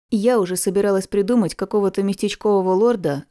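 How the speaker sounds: ordinary delivery, nothing marked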